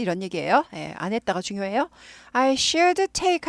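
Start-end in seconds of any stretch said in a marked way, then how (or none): 2.3-3.5 I should take said very slowly; drawn-out model phrase; slow demo read. Shown as a demonstration. Teacher reads the English line slowly. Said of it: "하면은".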